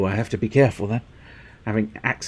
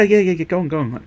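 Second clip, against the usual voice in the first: ordinary delivery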